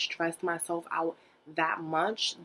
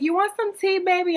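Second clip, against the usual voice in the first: high, louder doting grandma; granny asks her grandchild, much higher and louder; sweet granny voice; 12 semitones higher, 7 dB louder than usual. Retold or imitated. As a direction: play the granny offering tea sweetly.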